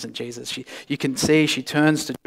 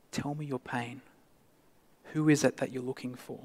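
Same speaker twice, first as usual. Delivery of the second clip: gentle and tender; 9 dB quieter than usual. Acted. Risen Jesus gently invites Mary to share her pain. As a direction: coax her to open up. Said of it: "comes as a gentle, loving, still".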